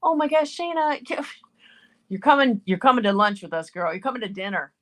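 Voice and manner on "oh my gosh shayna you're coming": ordinary talk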